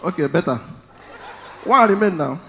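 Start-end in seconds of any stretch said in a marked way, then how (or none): none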